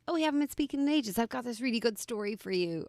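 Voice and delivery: lightly hammed-up put-on accent, quick and high; Johnny Junior put-on accent. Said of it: "Whatever accent I'm using".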